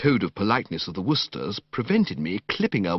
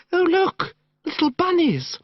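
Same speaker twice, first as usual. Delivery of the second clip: put-on gushing girl; 4 dB louder than usual. Madeline gushing over rabbits.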